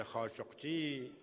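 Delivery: خاشقچی said the Arabic way, as Khashoggi with Arabic sounds; Arabic pronunciation. Shown as a demonstration. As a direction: say خاشقچی with Arabic consonants, not the Persian way. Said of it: "اینی که گفتم به لحن عربی بود".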